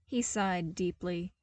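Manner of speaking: ordinary delivery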